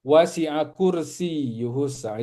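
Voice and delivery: slow recited Wasi'a kursiyuhus, y doubled; Quranic recitation. Shown as a demonstration. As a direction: recite the verse slowly, doubling y. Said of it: "ya-nya ditasdid tuh".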